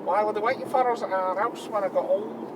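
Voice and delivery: lightly voiced Manc lad; Manc accent. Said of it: "I got the accent back".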